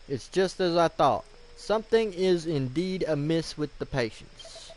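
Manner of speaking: grave Gerontius. Acted. Warning Larock and Ellie to protect themselves, emphatic. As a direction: deliver a solemn warning to your companions.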